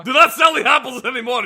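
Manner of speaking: exasperated order. Real, not acted. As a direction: tell him off, half laughing. Scolds about the apples.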